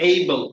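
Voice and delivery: Able with a gliding "ay-ee" first vowel; correct pronunciation. Shown as a demonstration. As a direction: model Able as "AY-bul", falling at the end. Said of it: "আমার সাথে বলো".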